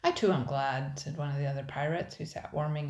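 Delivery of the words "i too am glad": pirate agreeing. A narrator's reading.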